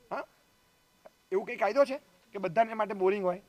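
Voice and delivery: normal talking voice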